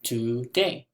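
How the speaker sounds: TODAY in two even beats, to, day; TODAY drawn out as to-day; split into syllables. Shown as a demonstration. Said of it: "there are two vowel sounds".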